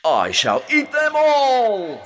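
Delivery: very high, loud, slow triumphant cry; monster roar. A narrator's reading. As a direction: roar out the boast. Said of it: "roared the monster".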